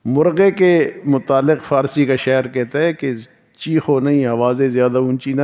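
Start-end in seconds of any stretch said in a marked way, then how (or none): none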